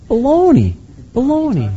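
high, scornful dismissal of the claim; mock it like a preacher waving off nonsense; scoffing baloney. Real, not acted. Preacher scoffs at money-hungry televangelists, high and scornful.

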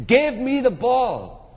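high, drawn-out athlete's demand; insistent demand. Acted. Preacher voicing star athlete wanting to score, insistent demand.

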